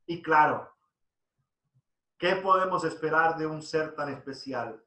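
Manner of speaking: ordinary talk, nothing marked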